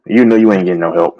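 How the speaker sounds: ordinary talk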